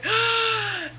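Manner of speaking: big high gasp of delight; a sudden gasp at the news; high startled intake of breath; sharp surprised gasp. Real, not acted. Recognizes baby news excitedly.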